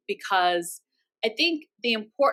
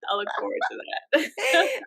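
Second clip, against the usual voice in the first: laughing; 9 semitones higher than usual. Real, not acted. Repeats while laughing.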